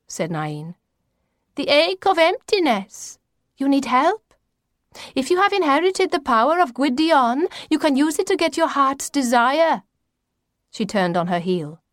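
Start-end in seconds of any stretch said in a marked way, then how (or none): 1.6-9.8 high, portentous grandmother voice; mysterious grandmother. A narrator's reading. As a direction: pitch it high, like a grandmother announcing destiny.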